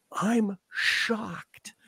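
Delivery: breathy whispered mock shock; sarcastic whisper. Real, not acted. A host whispering mock shock at a headline, sarcastic. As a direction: whisper it like fake surprise.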